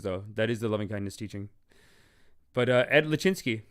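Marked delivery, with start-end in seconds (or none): none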